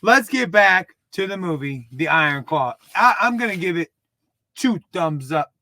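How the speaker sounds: ordinary delivery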